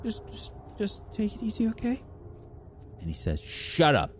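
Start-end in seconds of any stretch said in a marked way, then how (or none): 0.0-2.0 very high, breathless, fading panic; frightened woman pleading with her attacker; terrified pleading. Acted. 3.4-4.1 snapping shut up. Acted. Villain silences her.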